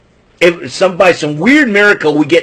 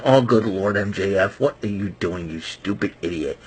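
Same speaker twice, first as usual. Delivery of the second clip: exasperated mutter; 8 semitones lower, 10 dB quieter than usual. Retold or imitated. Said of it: "I'm like face palming going".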